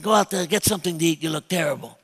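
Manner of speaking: lightly voiced gruff mob boss giving orders; a hint of the Godfather telling him to eat. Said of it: "And he said".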